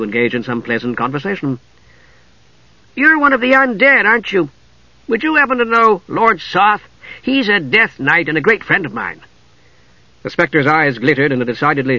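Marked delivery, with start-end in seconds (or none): 3.0-9.2 high, friendly kender voice; chatty kender. A narrator's reading. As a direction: make bright conversation with a stranger. Tass chats with spectre.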